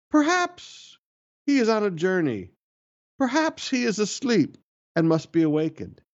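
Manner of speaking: sarcastic, "Perhaps" thrown up high; mocking. Elijah taunts the prophets of Baal, mocking and high. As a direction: sneer it out like a taunt. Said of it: "Elijah mocked them".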